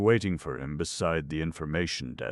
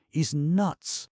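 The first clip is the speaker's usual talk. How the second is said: Joe's warning; 13 semitones higher, 5 dB louder than usual. A narrator's reading. Joe warning Skinny.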